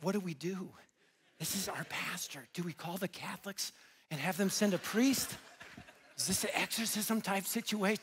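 hushed mock-bewildered onlookers; imitated worried whispering. Congregants conferring over their weeping pastor, imitated hushed alarm. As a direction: whisper it as a baffled aside.

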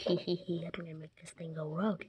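snickering sly whisper; sly nasal snickering whisper. Acted. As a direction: snicker and whisper like a sneaky saboteur. Villain sabotages robot secretly.

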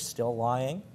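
plain reading voice

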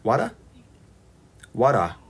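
"Water" tried out as chat spells it; corrected pronunciation. Shown as a demonstration. Streamer trying the pronunciation chat gave, careful "Water". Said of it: "You pronounce it as water".

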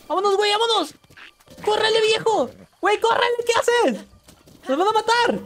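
high frantic yells to flee; panicked yelling. Real, not acted. Gamer fleeing enemies, panicked high yell. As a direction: scream at your buddy to run.

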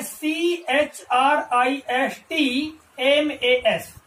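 letter by letter, very slow; slow spelling out. Shown as a demonstration. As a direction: spell it out one letter at a time, slowly. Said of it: "वो क्या लिखा है".

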